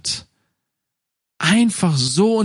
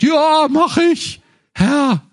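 eager son; 9 semitones higher, slower than usual. Acted. Son answering his father in the parable, eagerly. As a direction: sound overly willing to please.

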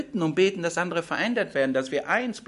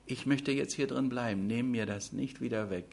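softer, hushed plea to God; a believer begging God to stay, softly; quiet prayer; 4 semitones lower, 7 dB quieter than usual. Retold or imitated. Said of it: "Ich danke".

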